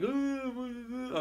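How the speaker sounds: high-pitched drawn-out whiny "uhhh"; exaggerated crybaby whine, held high; mock whining wail. Shown as a demonstration. Man shows the crying he did not do, mock whining. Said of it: "not, like, whining".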